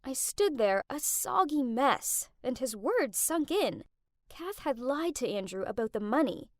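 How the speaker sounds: ordinary delivery, nothing marked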